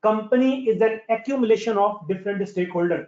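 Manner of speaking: very slow, word by word for note-taking; dictation pace. Shown as a demonstration. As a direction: dictate it slowly so they can write. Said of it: "लिखो अपने पास".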